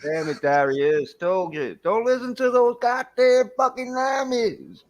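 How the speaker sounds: high, hammed-up Southern American scolding; Southern American accent. Brit mock-scolding his friend, high Southern American accent. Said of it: "you sound like a, uh, either".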